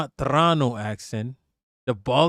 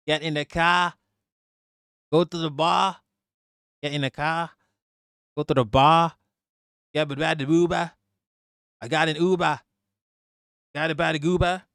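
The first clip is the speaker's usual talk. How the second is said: a light mock Boston accent, dropping the r's; lazily imitating a Boston local with Boston vowels. Streamer jokes about how he'd sound if born in Boston, light Boston accent. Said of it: "if I was born in Boston".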